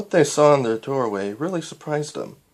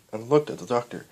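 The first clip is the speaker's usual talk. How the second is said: ordinary delivery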